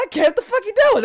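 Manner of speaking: high, startled what the hell; shocked and confused; startled outburst. Retold or imitated. Startled by stranger at urinal.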